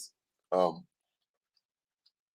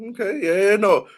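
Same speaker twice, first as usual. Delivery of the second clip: excited; 11 semitones higher, 6 dB louder than usual. Real, not acted. Eager agreement with guest.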